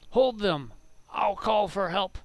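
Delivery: loud, high orc battle cry; orc voice actor. Acted. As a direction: shout it like a game orc calling out. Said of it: "the voice actors do. They'll be all like".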